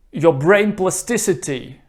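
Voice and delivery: ordinary talk, nothing marked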